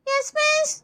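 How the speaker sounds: high, soft schoolkid answer; timid little-kid reply; child pupil. Acted. Pupil answering the teacher's call, soft high child voice.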